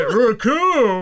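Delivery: high, loud, drawn-out name shout; hammed-up henchman's call; cartoon villain roll call. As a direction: bellow it like a battle cry.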